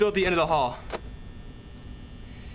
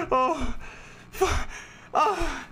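shaken gasps; 9 semitones higher, much slower than usual. Real, not acted. After jump scare.

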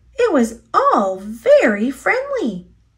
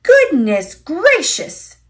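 a slow, higher, louder shocked cry; startled exclamation; 6 semitones higher, 4 dB louder, slower than usual. A narrator's reading. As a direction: exclaim it in scandalised surprise. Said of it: "exclaimed Missus Bird".

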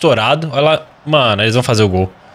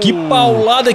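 sudden high excited exclamation; excited shout; 7 semitones higher, slower than usual. Real, not acted. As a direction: yell it out in amazement. Reacting to a shot in game. (grito animado)

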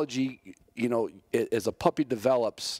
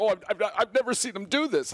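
flustered handler; 11 semitones higher than usual. Retold or imitated. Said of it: "he's like".

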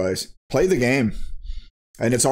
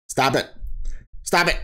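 insistent stop; 3 semitones higher than usual. Real, not acted. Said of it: "That's me".

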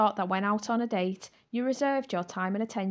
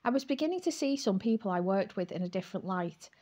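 usual speaking voice

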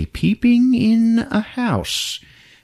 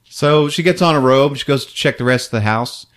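normal talking voice

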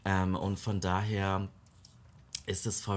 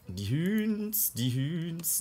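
mock high voice; 9 semitones higher, much slower than usual. Mocking sims' word for chickens.

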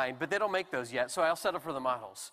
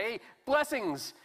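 friendly greeting; 7 semitones higher, slower than usual. Acted. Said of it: "this is how you would greet somebody".

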